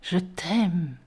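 soft murmured je t'aime; boy murmurs to Sarah on one knee, soft. A narrator's reading. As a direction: murmur it tenderly. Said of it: "je murmure".

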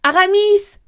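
high, urgent shout; shouted name. A narrator's reading. Athos calling to his friend, urgent high shout. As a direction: call out loudly to stop him. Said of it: "cria Athos".

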